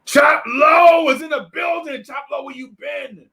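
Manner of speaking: excited shout. Real, not acted. Host calling out to a late friend, excited shout. Greeting a friend joining stream.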